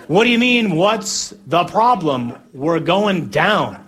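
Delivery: exasperated, drawn-out holler; incredulous protest. Acted. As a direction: protest in comic disbelief from a sinking boat. Disciples yell back in disbelief.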